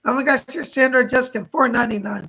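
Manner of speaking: real burst of delighted surprise; excited donor shout-out. Real, not acted. Host announces Sandra's donation to the stream, excited.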